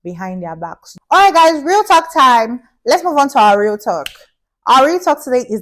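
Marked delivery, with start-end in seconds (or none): 1.1-4.1 loud, high, hyped call to start the show; big, bright show-host opener; hyped segment intro. Real, not acted. Host kicks off her segment.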